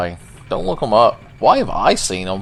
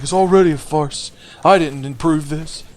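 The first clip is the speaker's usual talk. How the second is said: Oscar voice; 6 semitones higher than usual. Retold or imitated. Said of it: "He's like".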